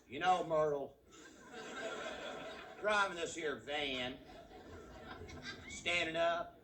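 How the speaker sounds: country husband talking to Myrtle; drawling Texan van driver. Pretend. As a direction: play the cramped driver griping to his wife. Voices a yokel van driver.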